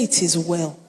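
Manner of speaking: low, soft, settled statement of faith; quiet assurance. Retold or imitated. Preacher voices bereaved mother answering her husband, quietly assured. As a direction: say it softly as a settled fact. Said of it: "that's not how she used it".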